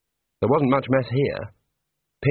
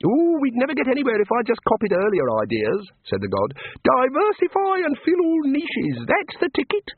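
high, bright small-god voice; cheerful little god; 13 semitones higher than usual. A narrator's reading. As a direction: play an eager little craftsman god. God character voice.

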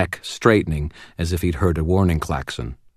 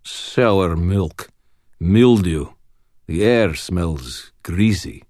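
put-on slow, measured Russian accent; deliberate Russian-accented report; much slower than usual. A narrator's reading. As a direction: list it as a Russian, word by word. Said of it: "the Russian reported".

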